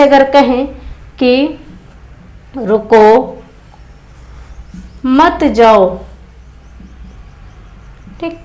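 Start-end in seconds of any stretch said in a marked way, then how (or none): 2.6-6.0 रुको, long pause, then मत जाओ together; low, deliberate रुको, then a break before मत जाओ; pause after रुको. Shown as a demonstration. Teacher dictating a punctuation example, pause after रुको. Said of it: "यहाँ ना जाने का भाव है".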